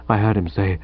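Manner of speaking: ordinary delivery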